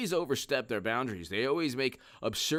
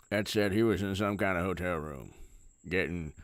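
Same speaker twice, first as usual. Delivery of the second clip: Mitch McConnell impression; 5 semitones lower than usual. Mocking McConnell as he speaks, marbles-in-mouth mumble. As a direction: talk as if marbles are in your mouth. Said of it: "Mitch McConnell speaks like".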